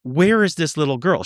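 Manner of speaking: high, insistent imitation of her panic; retold freak-out. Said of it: "She freaked out".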